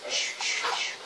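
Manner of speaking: soft whispered shushing. Pretend. Child sneaking in the game, whispering shh. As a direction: hush as if sneaking past.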